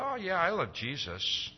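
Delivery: casual. Retold or imitated.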